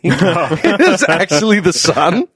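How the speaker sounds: laughing the words out, slow. Real, not acted. Teller to a friend, laughing as he recalls the sunrise. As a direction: giggle through it, slow.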